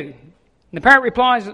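normal talking voice

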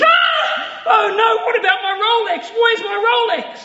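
high, loud shrieking panic; hammed-up scream of horror; panicked scream. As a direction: shriek in horror at the thought of the lost watch. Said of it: "he screams".

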